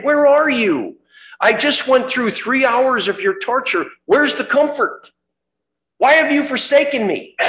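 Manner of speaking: high, loud, pleading cry to the Father; anguished cry of Jesus. Acted. Jesus crying out to the Father, high anguished plea. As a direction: cry out to God in abandonment. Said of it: "that's what caused him to say".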